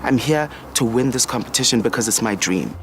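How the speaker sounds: ordinary talk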